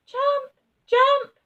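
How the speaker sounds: very high, loud cry; urgent shout. A narrator's reading. Someone urges the children to jump, very high and loud. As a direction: yell to jump. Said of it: "children urged to jump".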